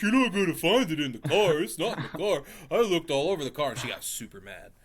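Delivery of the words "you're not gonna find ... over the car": higher mocking mimicry of wife; husband mocking wife's insistence in a snide voice; smart-ass imitation of wife. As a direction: parrot her words back sarcastically. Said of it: "a big smart ass about it".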